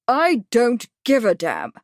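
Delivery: fierce. Acted. Bradford snapping at the Major, fierce. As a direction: bite back at him. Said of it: "Bradford said fiercely".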